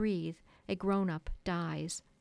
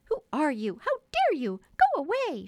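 shrill, much higher furious shout, building; angry cry; 8 semitones higher, 7 dB louder than usual. A narrator's reading. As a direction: snap at the intruder, louder each line. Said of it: "she cried".

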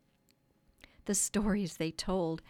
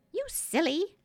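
very high, louder fairy squeal of indignation; Tinker Bell in a passion; 11 semitones higher, 4 dB louder than usual. A narrator's reading. Eavesdropping fairy to boy, high passionate outburst. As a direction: cry out like a tiny furious fairy. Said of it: "cried Tinker Bell in a passion".

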